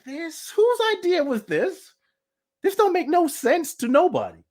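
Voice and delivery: high-pitched exasperated disbelief; baffled, re-enacted protest; incredulous objection. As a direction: question it indignantly, voice way up. Acts incredulous objection in argument.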